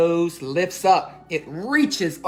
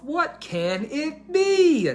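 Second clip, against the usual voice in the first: higher, slow puzzled musing; puzzled mouse; 9 semitones higher, slower than usual. A narrator's reading. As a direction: wonder aloud as the little mouse. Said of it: "thinks to himself".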